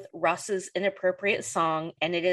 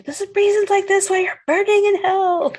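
high, loud put-on scolding voice; hammed-up churchy damnation; mock condemnation; 13 semitones higher, 8 dB louder than usual. Friend quoting a Friends character, mock fire-and-brimstone.

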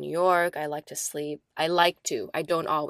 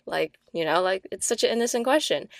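ordinary delivery

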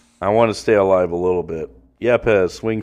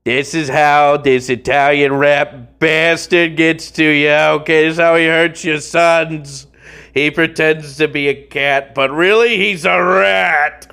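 mock villain; 6 semitones higher, slower than usual. Mock villain rant about pitcher.

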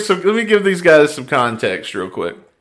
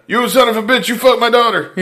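higher-pitched mock accusation; mock-outraged prank caller; 11 semitones higher than usual. Prank caller accusing the local DJ, mock outrage. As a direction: play an angry father, half laughing. Acts out angry prank call.

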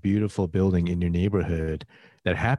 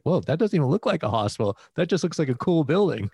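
retold wow, pitched much higher and quick; amazed; 9 semitones higher, faster than usual. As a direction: gush like you just spotted it. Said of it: "I was like".

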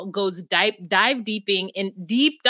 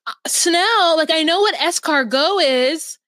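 higher, louder know-it-all tone; snooty friend; 7 semitones higher, 4 dB louder, slower than usual. Retold or imitated. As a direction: show off mock-sophisticated. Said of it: "And she's like".

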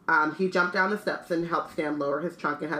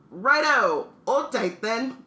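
higher, louder cheery call to a passenger; lightly voiced bus conductor; 6 semitones higher, 4 dB louder, much slower than usual. Conductor tells Harry to hold tight, lightly voiced cheery call. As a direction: call it out with dropped h's. Voices Stan in dropped-h dialect.

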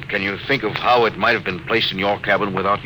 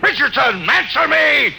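higher and louder, urgent yells; shouting orders; 4 dB louder than usual. Acted. Captain yells at an unanswering gun crew, desperate shouting. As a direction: bark fire at the silent gunnery.

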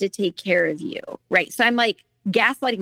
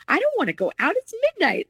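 much higher, pleading whine; whiny protest; 12 semitones higher than usual. Retold or imitated. Tired woman refusing her boyfriend, high whiny protest. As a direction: whine like a tired kid begging off. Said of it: "I remember thinking".

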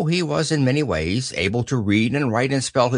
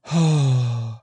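long, airy sigh; deep sigh. A narrator's reading. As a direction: let out a long, resigned breath. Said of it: "he gave a deep sigh".

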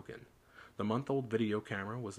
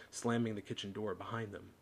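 usual speaking voice

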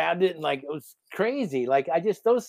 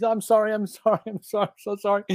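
higher, repeated pleading, mock tearful; retold frantic apology; 4 semitones higher than usual. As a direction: imitate a friend apologizing over and over. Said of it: "starts apologizing to the eel".

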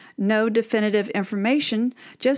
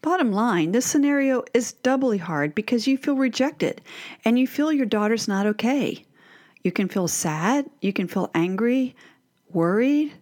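ordinary talk

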